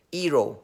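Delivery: "hero" without the H, as "ero"; dropped H. Shown as a demonstration. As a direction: start "hero" straight on the vowel. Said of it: "don't know how to pronounce the word H".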